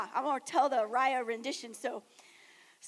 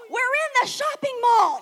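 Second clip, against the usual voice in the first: high, loud blurted announcement; sleep-talk shout; 9 semitones higher, 10 dB louder than usual. Retold or imitated. Emily wakes and announces it to the room in a high shout. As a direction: blurt it out half asleep. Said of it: "wakes up and she goes".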